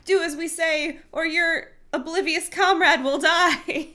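high, pompous command; demanding alien. A narrator's reading. Helmicrons demanding the humans grovel, high pompous voice. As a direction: order them to grovel.

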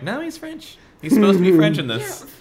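high-pitched mock insistence; retold insistent correction. Friend correcting people on his nationality, retold insistence. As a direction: correct them, voice lifted high. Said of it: "I'm like".

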